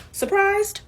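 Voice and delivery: high rhetorical question; mock surprised. Real, not acted.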